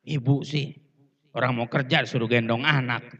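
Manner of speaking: low-pitched mock sulk of a husband; put-upon husband, lightly acted; sulky grumbling. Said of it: "ngambek tuh bapak".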